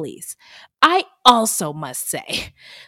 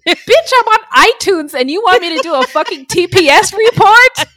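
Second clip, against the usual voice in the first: much higher and louder, brash pretend comeback; mock boss retort; 11 semitones higher, 10 dB louder than usual. At work, pretending to snap at her boss, mock loud. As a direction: tell off the boss like a star.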